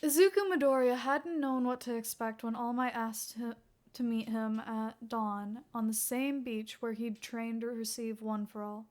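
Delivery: plain reading voice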